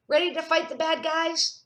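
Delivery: high-pitched rallying question; rally your brother to fight; excited child's battle call; eager kid challenge. Acted. Emily eager for play-fight.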